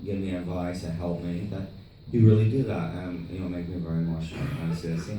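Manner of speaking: lightly imitated filmmaker, soft and low; subdued, earnest retold voice; Tommy. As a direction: mimic his broken English quietly. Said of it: "He goes".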